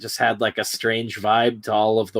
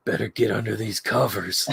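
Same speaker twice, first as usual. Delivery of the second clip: creepy toy voice; 2 semitones lower, 5 dB quieter than usual. Retold or imitated.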